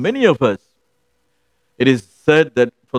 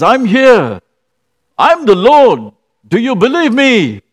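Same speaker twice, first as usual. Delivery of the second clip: powerful voice of the Lord; 7 semitones higher, 5 dB louder than usual. Retold or imitated. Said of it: "very powerful, strong voice".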